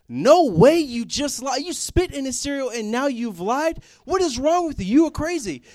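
high, loud accusing yell; other brother outraged. Retold or imitated. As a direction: yell the accusation like a scandalized kid. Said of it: "my other brother's looking at this like".

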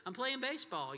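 teasing daddy answer; joking silly answer. Retold or imitated. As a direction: toss it off as a silly dad joke. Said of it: "so I said".

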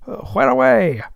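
high, loud hail up the mast; captain shouting up to the masthead lookout; calling aloft. Acted. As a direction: call up to the lookout across the deck.

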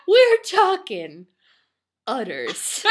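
loud slow build, then drop on udders; slow loud build to a flat reveal; dramatic emphasis. Acted. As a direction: pause before the payoff word. Dramatic punchline of the list.

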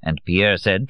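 plain reading voice

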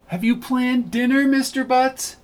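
very high, refined lady; high, classy voice. A narrator's reading. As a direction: ask it in a lifted, elegant voice. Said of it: "She spoke in a high, classy voice".

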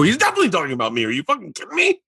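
fast, rising up high into giddy disbelief; giddy excitement. Retold or imitated. Answering his dad about Letterman, giddy disbelief. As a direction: rattle it off like you just won the lottery.

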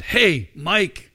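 loud, high calling-out shout; shout across stands. Retold or imitated. Voice in the crowd hailing someone across the stands, shouting. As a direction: call out to someone across a stadium. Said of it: "a voice, um, shouting across the stands".

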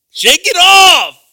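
very loud, high, slow shout; ringing shout. Real, not acted. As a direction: shout it out like a rallying cry. Preacher exhorting the congregation.

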